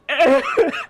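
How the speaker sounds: a loud, high laugh at his own joke; real burst of laughter. Real, not acted. Laughing at his own crying joke, a loud high laugh.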